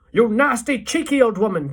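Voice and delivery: loud, furious insult; shouted. A narrator's reading. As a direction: shout abuse in outrage. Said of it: "shouted Mister Jenkins".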